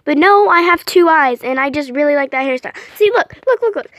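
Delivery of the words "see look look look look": eager. Real, not acted. Eagerly showing her character.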